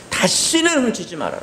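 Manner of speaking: high, firm warning; angry father warning; stern scolding. Retold or imitated. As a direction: scold the child sharply.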